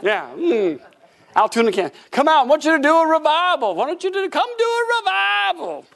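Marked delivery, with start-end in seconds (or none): 2.1-5.8 high, loud, eager small-town pastor; inviting pastor voice. Retold or imitated. Small-town pastor phoning to invite him, high eager voice.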